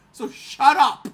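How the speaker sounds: loud, falling shut up, put down hard; shouted shut up. Retold or imitated. To his brother, a loud dismissive shut up. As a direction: tell him to shut up, loud.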